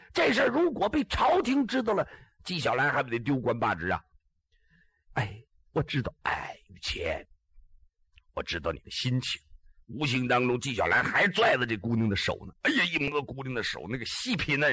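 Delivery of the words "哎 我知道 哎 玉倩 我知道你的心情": much lower and softer, near a whisper on her name; tender reassurance. Acted. Ji Xiaolan holding her hand, in a hushed tender voice. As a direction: speak low and intimate.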